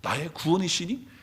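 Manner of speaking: ordinary talk, nothing marked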